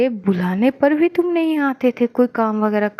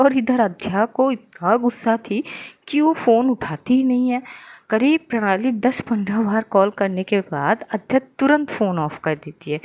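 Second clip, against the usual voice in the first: plain reading voice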